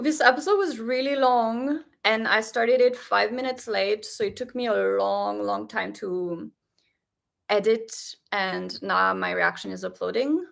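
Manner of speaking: ordinary talk, nothing marked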